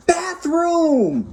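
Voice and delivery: put-on high kid shout; child yelling. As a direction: yell it like an urgent toddler. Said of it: "you yell out loud".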